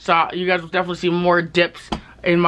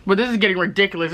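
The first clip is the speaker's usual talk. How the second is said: usual speaking voice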